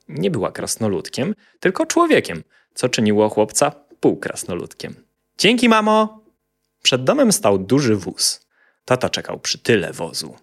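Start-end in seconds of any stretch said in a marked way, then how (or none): 5.4-6.2 high, loud cheerful thanks; little boy voice. A narrator's reading. Boy calling thanks to his mother. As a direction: chirp it like a happy kid.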